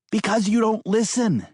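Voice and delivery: high, snappy woman's retort. Acted. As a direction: snap it back at him. Maddie snaps back.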